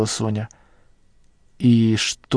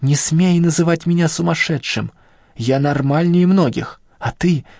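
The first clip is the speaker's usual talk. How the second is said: hysterical shout; 6 semitones higher than usual. A narrator's reading. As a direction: scream it, flaring up, voice high. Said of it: "выкрикнул высоким истеричным голосом".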